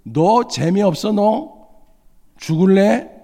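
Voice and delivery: retold stranger swearing down the phone, hostile and loud; angry threat. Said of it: "막 욕설을 부르니".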